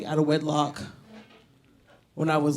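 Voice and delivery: ordinary talk, nothing marked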